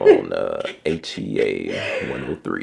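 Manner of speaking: deep, slow sexy radio voice. Pretend. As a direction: drop into a smooth late night voice. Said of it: "I gotta put my sexy voice on".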